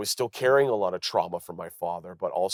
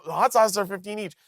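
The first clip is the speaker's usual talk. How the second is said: high-pitched hawker's patter; mock merch-seller call; 11 semitones higher, 4 dB louder than usual. Comic selling to fans in line, calling out.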